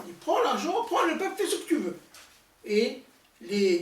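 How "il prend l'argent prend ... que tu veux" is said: high, laughing, loud carefree king; tossing off the request like a careless king. Retold or imitated. Mimicking the king's easy consent. (roi insouciant qui rit fort)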